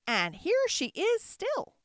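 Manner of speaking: high, slow, triumphant squeak; joyful squeaky baby bear. A narrator's reading. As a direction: squeak it slowly with glee as a little bear. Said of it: "squeaked the baby bear joyfully".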